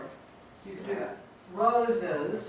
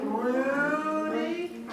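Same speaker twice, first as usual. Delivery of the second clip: higher, long-drawn RUUU-dy; stretched stress on RU; 6 semitones higher, 4 dB quieter than usual. Shown as a demonstration. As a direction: draw out the first syllable of Rudy. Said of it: "where do you hear the stress".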